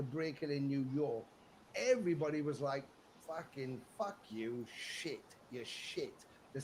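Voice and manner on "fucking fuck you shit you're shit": low, softer, slow contemptuous jeer; heckling sneer. Retold or imitated. New York crowd jeering Twisted Sister, low mock sneer.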